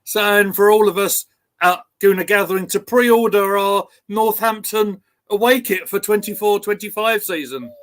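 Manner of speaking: ordinary talk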